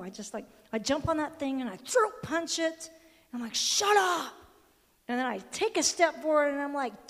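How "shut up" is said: high, loud burst; acted shout, pitched up and forceful; forceful retort. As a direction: silence that voice with a punchy shout. Acts out silencing the inner voice.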